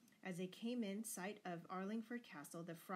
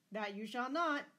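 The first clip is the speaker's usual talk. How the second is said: loud, higher-pitched refusal; bellowed; 5 semitones higher, 10 dB louder than usual. A narrator's reading. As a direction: forbid it at full voice. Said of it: "bellowed Lord Fitzwalter".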